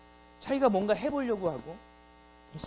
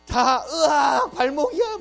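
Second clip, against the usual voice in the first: high, loud hammed-up cry of pain; a member wailing about his ankle; mock injured yelp; 9 semitones higher, 10 dB louder, much slower than usual. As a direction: fake a wounded ankle. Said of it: "막 이러고 있다니까요".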